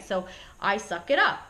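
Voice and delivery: ordinary talk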